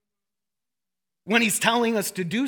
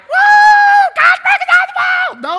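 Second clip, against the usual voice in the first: much higher and louder hammed-up woo shout; mock charismatic shout; 14 dB louder than usual. Pastor mocking shouting charismatics, hammed-up loud shout. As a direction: yell it like an overexcited charismatic. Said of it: "we're like".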